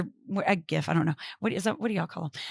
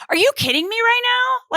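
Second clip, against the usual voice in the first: exasperated Martha; 11 semitones higher than usual. Acted. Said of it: "she's like".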